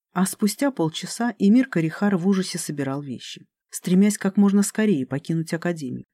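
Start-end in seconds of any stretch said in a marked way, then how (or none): none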